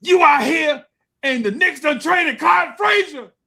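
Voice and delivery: high, loud gym-teacher call; mock shout. PE teacher calling out the trade news, mock shout. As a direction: yell the news across the courtyard. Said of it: "is like".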